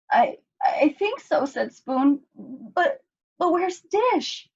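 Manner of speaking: high, halting voice; stutter, dazed; shaky spoon. A narrator's reading.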